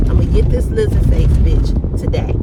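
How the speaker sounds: loud, furious outburst; angry threat. Real, not acted. Fed-up worker ranting about her coworker, loud angry threat. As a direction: let the anger boil over.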